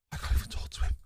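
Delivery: whispered in disbelief; hushed, helpless whisper; dismayed aside. Real, not acted. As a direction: say it under your breath, voiceless. Dismayed aside after failed approach.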